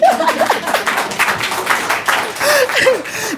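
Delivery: high, shaky laugh through tears; a laugh that is half crying; overwhelmed laughing sob; tearful laugh. Real, not acted. Overcome while telling of her honor.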